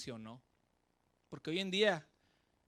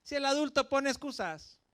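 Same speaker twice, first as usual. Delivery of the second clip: much higher and louder, driving it home; preaching emphasis; 8 semitones higher, 9 dB louder than usual. Real, not acted. Preacher challenging the congregation, loud and high. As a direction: hammer the point at the crowd.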